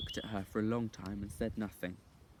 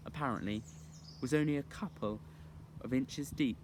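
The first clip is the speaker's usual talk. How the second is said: usual speaking voice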